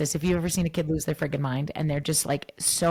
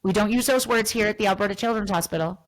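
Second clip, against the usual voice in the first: high, loud mock scolding of a child; hammed-up strict rule enforcer; stern scolding teacher; 4 semitones higher, 4 dB louder than usual. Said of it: "Should I be like".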